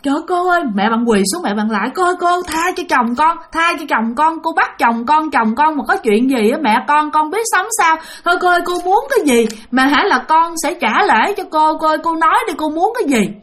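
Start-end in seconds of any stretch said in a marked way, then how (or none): 0.0-0.6 pleading. Retold or imitated. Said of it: "Mẹ bạn mới nói rằng". 2.0-13.3 higher-pitched begging, run on urgently; imitated wife begging for her husband; desperate pleading. Kneeling mother begs for husband's life.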